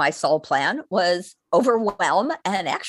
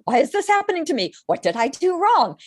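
high, fast self-pitying whine; victim voice; 9 semitones higher, 4 dB louder, faster than usual. Retold or imitated. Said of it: "that place of victim".

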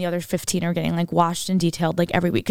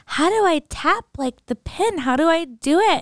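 higher, slower, baffled by the card reader; mock clueless Kris; 8 semitones higher, slower than usual. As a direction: ask helplessly like a pampered rich mom. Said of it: "And Chris is like".

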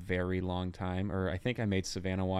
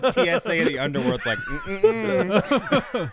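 high, loud, fast laughing impression; laughing mock voice; 11 semitones higher, 6 dB louder than usual. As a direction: squeal it out through laughter. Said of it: "And you're like".

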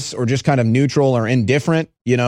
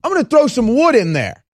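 exasperated quote; 10 semitones higher, faster than usual. Retold or imitated. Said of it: "You say".

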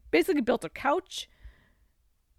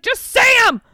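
high, loud exasperated shout; impatient yell; 11 semitones higher, 13 dB louder than usual. Retold or imitated. Urging her to read the magic words, loud and high. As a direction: snap at her to just say them. Retelling a character yelling.

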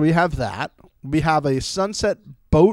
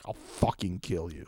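low, much softer menacing mutter; mock menacing threat; 10 semitones lower, 12 dB quieter than usual. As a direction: threaten under your breath, joking. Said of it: "looked at all the other camera people like".

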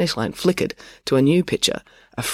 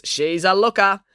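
higher-pitched cheeky heckle; jocular call; 7 semitones higher, 4 dB louder than usual. A narrator's reading. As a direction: toss it out as a joker. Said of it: "called a jocular voice".

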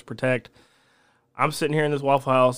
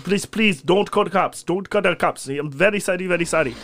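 pleading, higher-pitched imitation of an Arabic accent; broken-English Arabic accent; 4 semitones higher than usual. Drunk man's friend apologising to the cook, pleading Arabic accent. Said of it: "Only one dude spoke English, but barely".

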